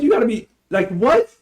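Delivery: high, baffled retold disbelief; incredulous reaction. Student sitting through the teacher's tangent, incredulous. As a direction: pitch it high, as if unable to believe it.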